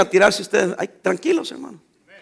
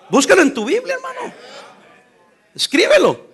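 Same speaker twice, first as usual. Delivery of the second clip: urging call; 9 semitones higher than usual. Real, not acted. Preacher urging the congregation.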